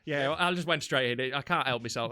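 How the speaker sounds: normal talking voice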